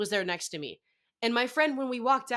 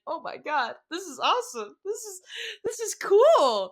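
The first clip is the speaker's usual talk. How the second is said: re-enacted giddy outburst, much higher; excited gushing; 10 semitones higher, 5 dB louder than usual. Fan reacting to friend's news, excited gushing.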